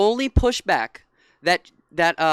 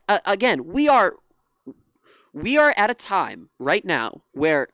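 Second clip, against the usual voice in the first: ordinary talk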